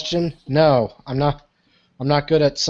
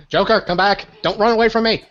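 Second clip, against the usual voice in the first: raised pitch, mock-urgent call; higher, insistent callout; calling after someone; 6 semitones higher, 4 dB louder than usual. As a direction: call him back as he walks off. In character, calling after Joker.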